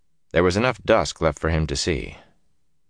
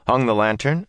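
plain reading voice

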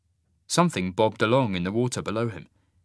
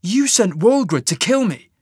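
loud, higher accusation; accusing; 10 semitones higher, 8 dB louder than usual. Acted. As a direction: hurl the charge at him. Cole accusing Marius.

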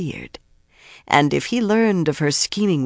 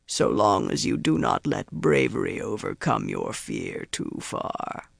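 low and measured, sinking softer into a warning; menacing Myrddraal; 9 semitones lower, 4 dB quieter than usual. A narrator's reading. Myrddraal warning Grendal not to be too brave, menacing.

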